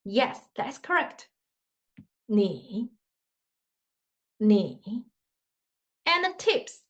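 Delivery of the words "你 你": 你 said low with a dip; low throaty third tone on 你; third tone demo. Shown as a demonstration. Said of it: "touch your throat".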